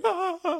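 high-pitched mock cries of fear; imitated frightened whimpers. Imitating nurses cowering in the corner, mock frightened cries. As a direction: squeal in short, high, pretend-terrified bursts.

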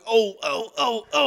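high, rhythmic mock moaning; repeated high-pitched "oh" moans, played for laughs; imitated moaning noises. Comic imitating the actress's noise in the scene, mock moans. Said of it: "Doesn't she do, like, a noise".

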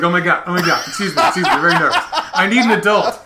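loud, high panicked squeal; shrieking jackpot winner. Retold or imitated. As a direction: shriek it like a nervous wreck. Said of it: "And Bashir's like".

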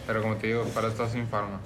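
usual speaking voice

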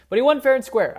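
emphatic concession. Real, not acted. Conceding loss to friend.

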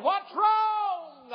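high, loud drawn-out call; hollered question. Retold or imitated. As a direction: holler like a wife calling from the fence. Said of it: "I hollered back".

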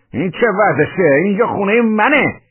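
high, loud indignant yell; emboldened shout. A narrator's reading. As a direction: shout your claim to the place. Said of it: "داد زدم".

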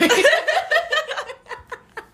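high squealing laugh; high burst of laughter. Real, not acted. Big laugh.

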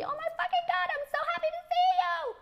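shrieked high and loud, rushed; squealing excitement. Retold or imitated. Excited friend translating a French hello, shrieking fast. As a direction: burst out like you can barely contain joy. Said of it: "the translation will be".

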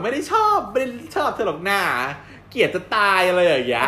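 high, loud huffy tsundere voice, mock denying; tsundere denial. Podcast host imitating a tsundere girl who denies her crush, high and loud. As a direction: play the flustered tsundere who insists she hates him. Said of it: "แต่เราจะอินกับความซึน".

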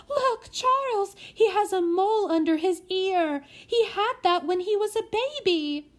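mother spotting her son's mole; excited lady. Acted. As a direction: squeal it out, high and thrilled.

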